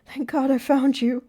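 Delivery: high, loud, relieved outburst; relieved urgency. A narrator's reading. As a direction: let relief burst out urgently. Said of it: "she sighed".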